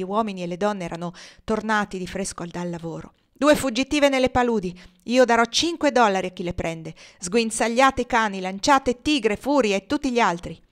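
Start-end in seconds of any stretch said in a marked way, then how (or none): 3.3-10.6 loud, higher commanding shouts; shouted orders. Acted. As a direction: bark orders to the whole yard. Legree orders the hunt. (Legree urla ordini agli schiavi, forte e autoritario)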